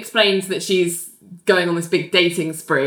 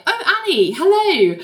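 John greeting; 12 semitones higher than usual. Retold or imitated. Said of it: "John's like".

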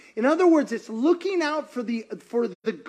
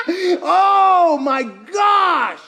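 high laughing outburst, slow and louder; big real laugh; 6 semitones higher, 5 dB louder, much slower than usual. Real, not acted. Preacher to congregation, laughing out loud at his point. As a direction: crack up out loud.

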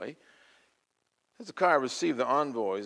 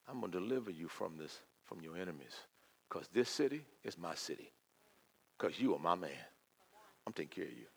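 soft, reassuring promise; tender voice of God; 6 semitones lower, 13 dB quieter than usual. Acted. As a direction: promise it softly, like a father.

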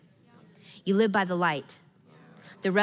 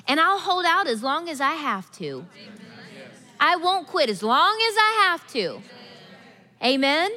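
fervent declaration; 5 semitones higher than usual. Real, not acted. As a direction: declare it with conviction.

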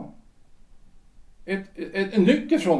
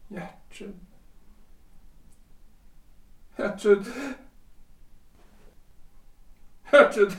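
calling out, building; 5 semitones higher, 3 dB quieter than usual. Acted. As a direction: call her name from far off to full voice. Calling for his departed wife.

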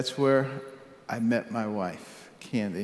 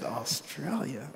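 slow, soft, incredulous "Australia?" drawn out; a quiet, stunned echo of the offer; dismayed disbelief; 9 dB quieter, slower than usual. Retold or imitated. Surgeon echoing the invitation to move abroad, in quiet disbelief. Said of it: "I didn't say that out loud".

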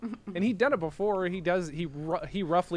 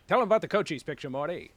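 put-on quick, punchy old-timey showman delivery; old-timey movie voice; 3 semitones higher, 7 dB louder, faster than usual. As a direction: rattle it off like a vaudeville ham. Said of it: "Like that, like him doing that thing".

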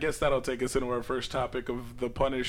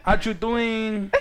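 mock Mexican accent; 6 semitones higher, 4 dB louder, much slower than usual.

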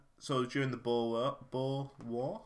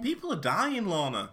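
mock indignant retort; 6 semitones higher, 7 dB louder than usual.

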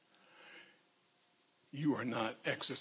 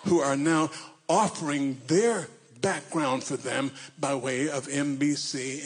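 slow, loud sermon cry; preaching at full force; 5 dB louder than usual. Real, not acted. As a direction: drive it home from the pulpit. Preaching peak.

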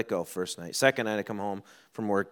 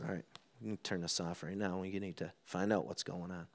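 much softer, lower fatherly voice; calming a child; 5 semitones lower, 13 dB quieter than usual. Retold or imitated. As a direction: soothe your tearful son quietly. Father calming his tearful son.

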